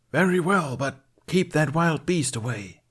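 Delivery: lightly voiced villain reply; accuser role. A narrator's reading. Accusers accept the knight's challenge, as stage villains. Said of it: "alternating between her now four onstage roles".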